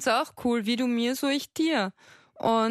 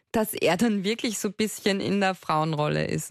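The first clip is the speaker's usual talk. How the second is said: ordinary talk, nothing marked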